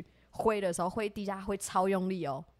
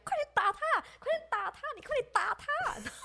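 much higher, dragged-out nagging plea; imitating frantic urging. Friend nagging the speaker to hit the cockroach, high frantic urging. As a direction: hurry them in a shrill panic.

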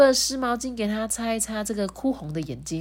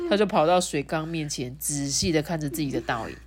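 ordinary delivery

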